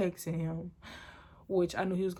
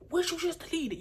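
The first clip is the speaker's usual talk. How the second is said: retold freak-out; 9 semitones higher than usual. Boyfriend who saw her delete the text, imitated freaking out. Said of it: "he just freaked out".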